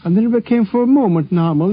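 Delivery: ordinary talk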